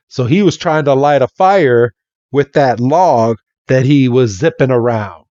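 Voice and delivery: normal talking voice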